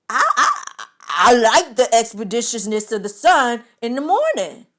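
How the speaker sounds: mock confident date. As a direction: say it with full confidence. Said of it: "confidently, like, with no hesitation".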